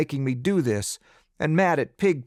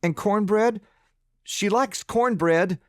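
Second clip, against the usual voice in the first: put-on hopeful child; 9 semitones higher than usual. Boy pleading for his pig.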